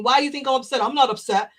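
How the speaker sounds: mildly defensive "not upset" with a bite. Retold or imitated. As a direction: push back lightly, sounding upset. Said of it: "Well, you sound it".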